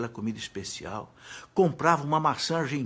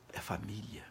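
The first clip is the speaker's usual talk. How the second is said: much softer whispered "É família"; 3 semitones lower, 10 dB quieter than usual. Real, not acted. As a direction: whisper "É família" as a quiet revelation. Repeated phrase, first take whispered. ("É família" sussurrado)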